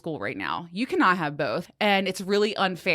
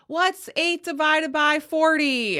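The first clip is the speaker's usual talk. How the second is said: daughter calling out question; 9 semitones higher, 3 dB louder, slower than usual. Retold or imitated. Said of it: "she just says".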